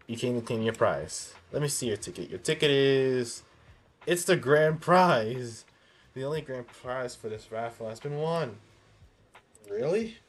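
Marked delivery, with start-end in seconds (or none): 2.3-5.6 slow, high, loud grand reveal; booming prize announcer. Acted. Raffle clerk reveals grand prize. 9.7-10.2 surprised. Acted. Reacting to grand prize win.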